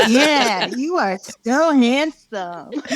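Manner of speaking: hammed-up proud-parent cooing; gushing praise. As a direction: drag out the compliment adoringly. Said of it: "And I was like".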